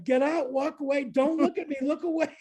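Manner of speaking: high, urgent shouted orders; frantic order. Retold or imitated. High frantic orders telling her friend to walk away. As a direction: shoo her away in desperation.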